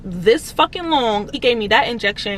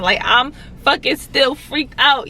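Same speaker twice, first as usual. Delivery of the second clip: ordinary talk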